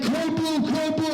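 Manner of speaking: hammed-up emergency; mock alarm.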